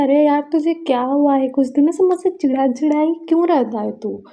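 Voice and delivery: exasperated confronting. Retold or imitated. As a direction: demand an answer from a sulky friend. Friend confronts sulking friend.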